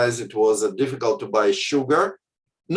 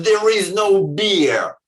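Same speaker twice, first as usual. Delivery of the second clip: barked out louder and slow, as a rude command; harsh shouted notice; 5 semitones higher, 4 dB louder, slower than usual. Shown as a demonstration. Kiosk sign imagined as a rude shout at customers. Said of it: "they've written".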